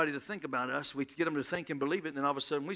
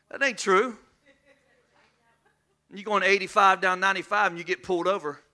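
usual speaking voice

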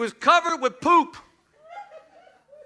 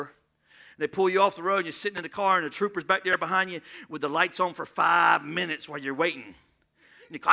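usual speaking voice

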